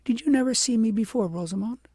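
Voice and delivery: eager to be recognised; child asking Rosamond; quick little girl. A narrator's reading.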